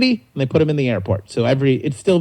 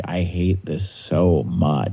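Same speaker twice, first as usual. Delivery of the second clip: slow and low, softer, worn down; weary groan; 6 semitones lower, 3 dB quieter, much slower than usual. Retold or imitated. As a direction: drag it out like a tired worker at dawn. Weary worker voicing the bad days.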